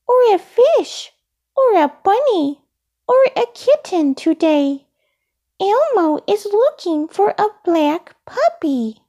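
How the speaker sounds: high puppet voice listing item by item; high childlike character; Elmo, insistent. A narrator's reading. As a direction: insist in a small high voice, pausing between items. Elmo corrects salesperson.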